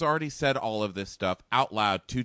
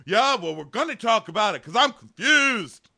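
loud, high boyfriend impression; hammed-up exasperated bellowing; mock angry yell; 10 semitones higher, 8 dB louder than usual. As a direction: shout her down in a mock argument. Voicing Josh shouting over Amanda.

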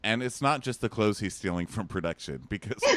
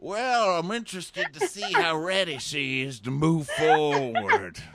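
high, slow mock old-timer; playful old man impression; 7 semitones higher, slower than usual. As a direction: ham up a creaky old construction worker. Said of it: "old man construction worker is like".

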